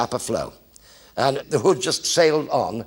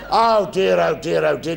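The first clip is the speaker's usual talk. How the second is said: mock dismay; 5 semitones higher, 4 dB louder than usual. Recruit dismayed at scattering squad.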